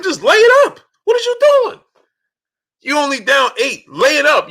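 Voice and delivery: very high, frantic sports-fan yelling; real emotional outburst. Real, not acted. Fan yelling at a player to lay it up, emotional outburst. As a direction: plead with the player in exasperation, voice way up high. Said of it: "I get emotional about".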